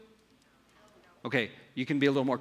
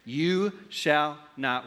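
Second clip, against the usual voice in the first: "You shall not" धीरे खींच कर; slow, unfinished quote; much slower than usual. Shown as a demonstration. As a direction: draw out "You shall not" as a fill-in prompt.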